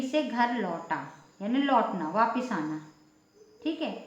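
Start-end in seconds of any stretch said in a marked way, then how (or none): none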